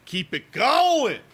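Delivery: high, slow, stretched-out rallying cry; drawn-out cheer. Real, not acted. Host cheering on Bob for today, drawn-out cheer. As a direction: stretch it out high, urging the goalie on. Urging goalie on, drawn-out repeat.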